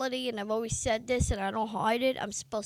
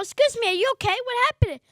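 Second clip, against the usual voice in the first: concerned crew; 11 semitones higher, 3 dB louder, much faster than usual. Retold or imitated. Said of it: "Everybody's going".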